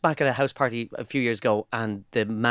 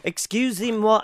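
slow, higher French accent; put-on French accent; 7 semitones higher, slower than usual. Shown as a demonstration. Tourist trying French in France, higher, slow put-on French accent. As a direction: lay the French accent on carefully. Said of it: "you try and put on the accent".

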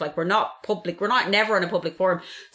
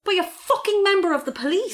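outraged; 12 semitones higher than usual. Real, not acted. Outraged emphasis.

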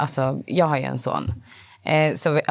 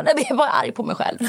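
put-on higher, quick little boy voice; son's answer, done much higher and fast; imitating young son; 9 semitones higher, faster than usual. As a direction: rattle it off like a small upset boy. Mother quoting her son again.

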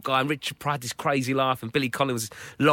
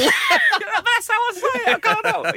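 high, slow, laughing whine; mock defensive host; 5 dB louder, much slower than usual. Host defending his pronunciation to his wife, mock whine. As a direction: whine like a caught-out husband.

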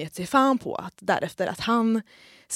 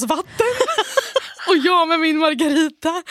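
high, louder laughing aside; 7 semitones higher, 5 dB louder than usual. Real, not acted. Friend describing her drink, laughing. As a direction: giggle the words out.

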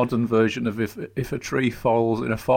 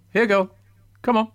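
higher-pitched coaxing of a dog; modest dog voice; 10 semitones higher, 3 dB louder, faster than usual. Shown as a demonstration. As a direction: call the dog along in a restrained pet voice. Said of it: "that's as dog voice as I got".

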